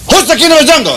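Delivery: high, loud lion roar of a question; hammed-up nasty lion challenge. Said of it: "the lion was very nasty".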